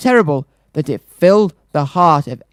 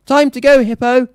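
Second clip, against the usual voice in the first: calling; 8 semitones higher than usual. Acted. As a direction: call out across the field. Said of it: "the mayor called to the beast".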